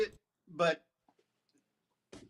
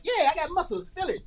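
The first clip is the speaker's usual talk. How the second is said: put-on proud teen boy; 10 semitones higher than usual. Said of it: "He'll be like".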